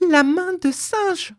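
high, drawn-out frantic cry; distraught cry. A narrator's reading. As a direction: cry it out slowly and wildly. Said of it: "cria-t-elle d'une voix égarée". (cri égaré, aigu)

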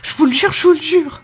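high, urgent rush of words; hasty swearing. A narrator's reading. Servant girl swearing to her master, hastily. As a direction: blurt out the oath in a panic. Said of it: "Elle répondit précipitamment".

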